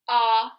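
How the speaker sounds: A said as the short O in "hop", "ah"; short O sound. Shown as a demonstration. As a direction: hold A loudly as an "ah" phonics sound. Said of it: "is making its short sound".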